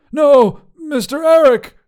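high, loud whimpering wizard; sniffling plea. A narrator's reading. Wizard begs the man with the sander, sniffling loudly. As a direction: wail it in a high, pleading voice. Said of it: "Grandois sniffled".

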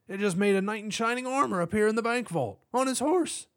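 high-pitched, incredulous cop; excited cop voice, way up in pitch. A narrator's reading. Cop excitedly reports the knight.

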